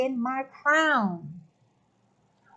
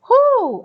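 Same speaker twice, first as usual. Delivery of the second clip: loud emotional whew; 11 semitones higher, 9 dB louder than usual. Real, not acted. Overwhelmed by emotion.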